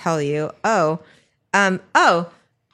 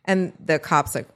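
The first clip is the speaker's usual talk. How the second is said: normal talking voice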